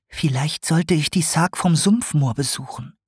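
put-on young owl wondering aloud; owl musing aloud. A narrator's reading. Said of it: "dachte sie".

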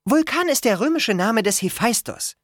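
put-on high, matter-of-fact girl's voice; explaining Annabeth. A narrator's reading. Girl explains the god's names, informative and high.

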